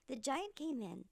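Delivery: usual speaking voice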